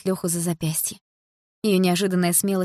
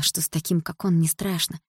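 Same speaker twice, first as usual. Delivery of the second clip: ordinary delivery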